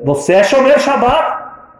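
high, slow, questioning wife; drawn-out suspicious question. Retold or imitated. Wife presses the visitor, slow and high. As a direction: stretch the question out, incredulous.

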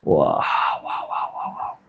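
low breathy wailing cries; whispered cries of grief; anguished wail. Acted. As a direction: mourn the crushed matzot aloud. Pained reaction to trampled matzot.

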